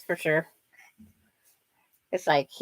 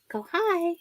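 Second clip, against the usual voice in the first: put-on high baby talk; 10 semitones higher, 5 dB quieter than usual. Prompting baby to greet.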